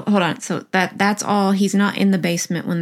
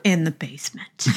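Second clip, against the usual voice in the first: mock whisper; 2 semitones lower, 4 dB quieter than usual. Joke whisper after the fake call.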